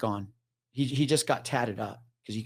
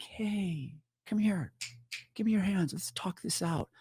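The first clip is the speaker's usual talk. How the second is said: higher, gentle coaxing voice; coaxing calm; 8 semitones higher, 5 dB quieter than usual. Retold or imitated. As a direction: soothe her like a spooked animal. Talking down the agitated woman.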